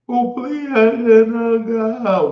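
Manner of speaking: high, slow imitated whimper of a little girl; mock crying child. Uncle mimicking his niece sobbing as he leaves, pleading. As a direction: beg like a child who won't let go. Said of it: "She would cry".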